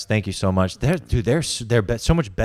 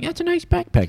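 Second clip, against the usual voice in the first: put-on very high, squeaky kid voice. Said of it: "All the kids were like".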